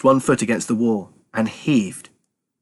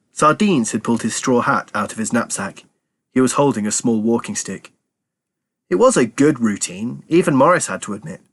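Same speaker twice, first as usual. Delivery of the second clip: ordinary delivery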